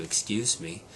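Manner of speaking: ordinary delivery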